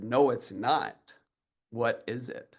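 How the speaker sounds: lightly voiced grandpa repeating slowly; grandpa's slow repeat. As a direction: drag it out like a stubborn grandpa.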